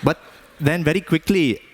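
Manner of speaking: put-on Indian accent, storytelling; measured Indian-accented English. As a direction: tell it in a father's Indian accent. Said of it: "why I'm even talking like this".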